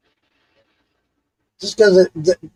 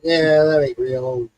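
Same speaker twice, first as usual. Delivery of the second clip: sceptical scoff; 5 semitones lower, 4 dB quieter, slower than usual. Retold or imitated. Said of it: "you're like".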